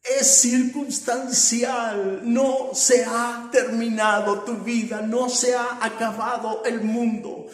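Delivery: loud, slow, rising preacher's proclamation; preaching at full force. Acted. Preacher proclaiming God's power to the congregation, full force. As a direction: drive each phrase home to the church.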